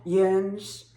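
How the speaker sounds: "yins" stretched out, as the holler says it; dialect "yins". Shown as a demonstration. Showing viewers one holler's word, dialect "yins". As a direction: say "yins" as one drawn-out syllable. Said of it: "one holler would say yins".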